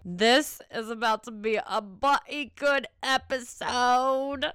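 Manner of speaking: playful put-on British accent, a bit slow. Host teasing her listeners in a British accent. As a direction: ham up a posh British "bloody good". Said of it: "I just have to have my British voice come out".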